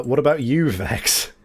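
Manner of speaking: ordinary talk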